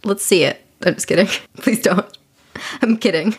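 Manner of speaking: ordinary talk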